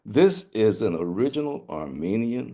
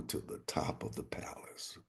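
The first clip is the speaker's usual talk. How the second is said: soft whisper; whispered narration; 16 dB quieter than usual. A narrator's reading. Narrator building suspense, whispered. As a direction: lean in and breathe the line.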